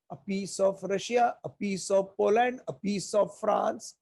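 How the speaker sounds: usual speaking voice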